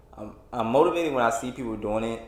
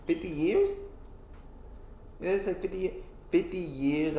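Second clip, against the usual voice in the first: higher-pitched disbelief at the figure; incredulous; 8 semitones higher, 3 dB quieter than usual. Real, not acted. Worker totting up years left until retirement, incredulous. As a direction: balk at the number in a raised pitch.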